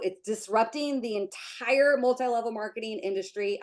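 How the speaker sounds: ordinary delivery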